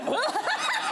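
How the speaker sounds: high croaky stammer, caught off guard; frog in throat. Retold or imitated. Retelling a stranger's good morning, croaky high stammer. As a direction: choke out the greeting like a frog is stuck in the throat. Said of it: "I had the frog in my throat".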